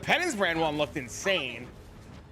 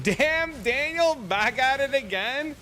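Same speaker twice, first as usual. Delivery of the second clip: high, loud mock meme line; sing-song viral video quote; 9 semitones higher, 4 dB louder, slower than usual. Streamer quoting the meme to chat in a high voice. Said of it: "quoting the meme again".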